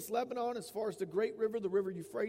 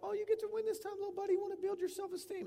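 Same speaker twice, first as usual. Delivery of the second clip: put-on much higher, sugary voice talking down to a little kid; mock coddling voice; 12 semitones higher, faster than usual. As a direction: coo it like a parent letting a child win, mocking it. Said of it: "There's no, like".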